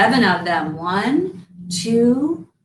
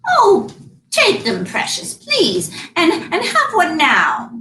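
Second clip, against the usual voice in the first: a hint of a wheedling crone, a bit higher; lightly voiced old beggar woman; 6 semitones higher, 3 dB louder, slower than usual. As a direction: coax her sweetly like the old crone. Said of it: "She told me".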